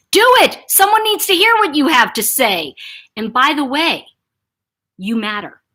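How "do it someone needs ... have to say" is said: loud, high rallying urge; emphatic, supportive push; encouraging intonation. Shown as a demonstration. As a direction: cheer them on to speak up. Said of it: "What I'm trying to say is".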